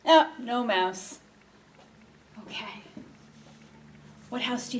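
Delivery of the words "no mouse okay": plain reading voice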